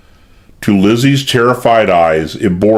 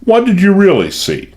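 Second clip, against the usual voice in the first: forced urbanity; 10 semitones higher than usual. A narrator's reading. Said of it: "forcing himself to urbanity".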